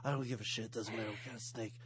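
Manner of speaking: low, soft tough-guy voice; Snake impression. Tough prisoner asking tattoo artist for snake, low and soft. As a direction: drop the voice low and quiet like a hard man. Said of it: "Amazing snake imitation".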